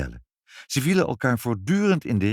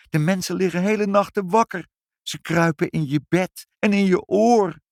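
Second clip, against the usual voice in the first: high, louder breathless-style listing; mounting alarm; 12 semitones higher, 5 dB louder than usual. A narrator's reading. Mother relays the cats' news of mice, alarmed. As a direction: pile up the horrors excitedly. Mother reports the mouse plague.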